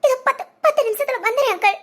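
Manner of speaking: stammering through sobs; tearful. Acted. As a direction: promise to come while breaking into sobs. அதிர்ச்சி செய்தி கேட்டு அழுதல். (அழுதுகொண்டே தடுமாறி)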